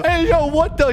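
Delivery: high, loud shocked reaction; startled outburst. Real, not acted. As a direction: blurt it out high in disbelief. Startled as the confrontation begins.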